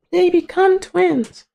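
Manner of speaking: slow, very high squeaky repeat; mock falsetto. Repeating the plot point to viewers, mock falsetto. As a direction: stretch it out in a tiny falsetto.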